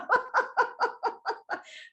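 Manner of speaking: amused unvoiced chuckle; breathy laugh. Real, not acted. Laughs at own story.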